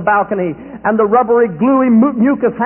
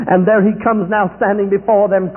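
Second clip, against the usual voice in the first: ordinary delivery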